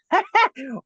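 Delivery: high, loud character laugh; put-on high jeering laugh; laughing head's cackle. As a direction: burst into a giddy monster cackle. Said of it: "laughing one's like".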